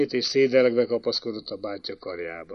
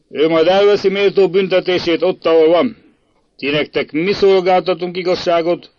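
loud, raised accusing proclamation; stern condemnation; 4 semitones higher, 7 dB louder than usual. A narrator's reading. Prince condemning the boys' father, loud and severe. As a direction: pronounce judgement with indignation. Prince passes judgement on boys.

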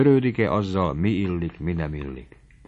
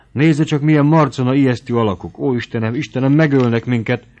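panicked fear; 5 semitones higher, 7 dB louder than usual. A narrator's reading. Girl panics at the fire.

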